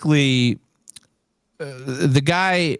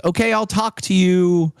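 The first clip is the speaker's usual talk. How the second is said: higher, prim businesslike voice; official caller lady; 8 semitones higher than usual. Retold or imitated. Caller from Aristocrat questions the winner, prim official. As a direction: read it like a formal call-centre script.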